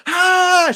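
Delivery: high, loud imitated battle yell; warrior cry. Wonder Woman as the theme swells, imitated war cry. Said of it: "when she goes".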